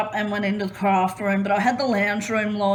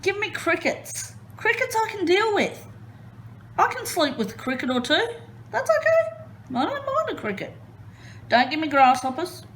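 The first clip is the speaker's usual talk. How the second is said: much higher, breezy and light; playful high voice; 8 semitones higher than usual. Real, not acted. Telling listeners crickets are fine, high and breezy. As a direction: chirp it like it's no big deal.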